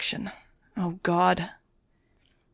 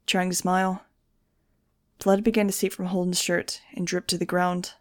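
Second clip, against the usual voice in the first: ordinary delivery, nothing marked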